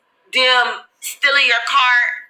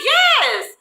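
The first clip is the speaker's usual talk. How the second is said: emphatic yes. Retold or imitated. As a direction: shout it, finally believed. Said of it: "I'm like".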